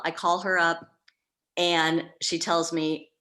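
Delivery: ordinary talk, nothing marked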